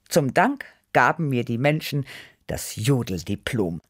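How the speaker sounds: ordinary delivery, nothing marked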